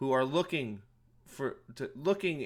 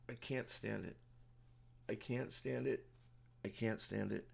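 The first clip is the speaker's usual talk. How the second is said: much softer, drained repetition; exhausted mutter; 2 semitones lower, 12 dB quieter than usual. Real, not acted. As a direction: let it fade out tired and beaten. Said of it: "I am so tired".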